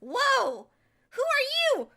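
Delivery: high, amazed, tumbling questions; astonished babble. Acted. Meeting the stranger and her friends, high and astonished. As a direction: gasp it all out in wonder. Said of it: "I'm like".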